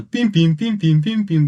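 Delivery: high beeping pim-pim noises; camera beep imitation. (подражание писку камеры)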